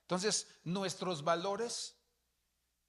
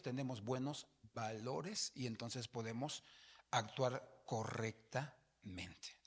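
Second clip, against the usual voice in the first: ordinary talk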